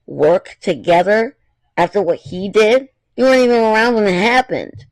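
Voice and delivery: mildly voiced angry Miu; scornful girl. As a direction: throw the words back at her. Miu scoffs back.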